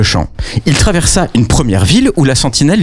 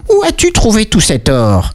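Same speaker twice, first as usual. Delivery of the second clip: intrigued king; 9 semitones higher than usual. Acted.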